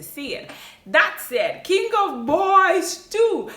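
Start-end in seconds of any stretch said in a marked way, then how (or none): 1.6-3.5 slow, high and loud, like a trailer voice; dramatic title announce. Acted. Announcing next movie title.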